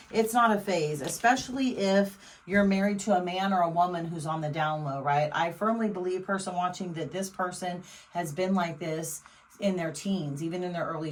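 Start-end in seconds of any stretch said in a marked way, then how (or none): none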